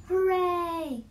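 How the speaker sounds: high, loud hooray; cheering shout. A narrator's reading. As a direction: cheer it out as a happy group. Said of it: "they all shouted".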